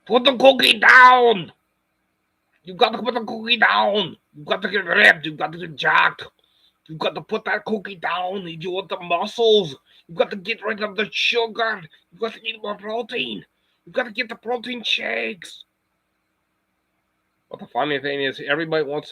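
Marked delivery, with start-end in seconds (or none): 0.1-1.5 high, loud, drawn-out shouted Austrian impression; Arnold Schwarzenegger. Streamer doing a funny outro, shouted Arnold impression. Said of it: "try talking like Arnold". 2.6-15.6 high-pitched yelling Austrian strongman impression; Arnold Schwarzenegger. Streamer coaching viewers to get ripped, mock-yelling Arnold impression. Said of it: "try talking like Arnold".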